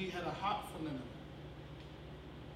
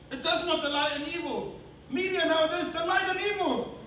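raised, loud sermon delivery; forceful preaching; 8 semitones higher, 7 dB louder than usual. Real, not acted. Preacher denouncing media's delight in evil, forceful preaching. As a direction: drive it home to the congregation.

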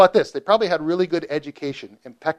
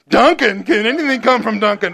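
teasing mockery; 6 semitones higher, 5 dB louder than usual. Teasing Nathan about Duncan.

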